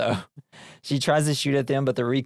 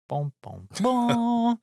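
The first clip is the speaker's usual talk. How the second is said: mock boom; 12 semitones higher, much slower than usual. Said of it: "sound effect flourish".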